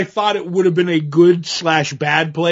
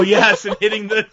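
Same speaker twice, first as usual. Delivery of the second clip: ordinary delivery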